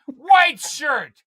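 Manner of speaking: shouting over everyone, loud and high; screaming. Real, not acted. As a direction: yell each item out high. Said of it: "you just start screaming over".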